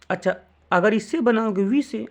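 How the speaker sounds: ordinary talk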